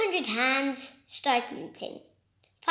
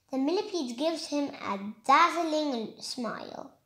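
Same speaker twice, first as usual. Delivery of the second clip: ordinary delivery, nothing marked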